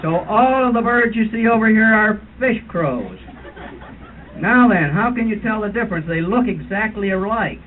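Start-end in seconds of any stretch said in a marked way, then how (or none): none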